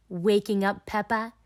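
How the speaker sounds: usual speaking voice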